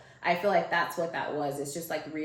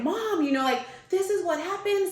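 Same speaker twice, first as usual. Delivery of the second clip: high, loud imitation of upset pleading; retold tearful pleading; 13 semitones higher, 4 dB louder than usual. Daughter freaking out on phone to mom, mock tearful. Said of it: "I start crying".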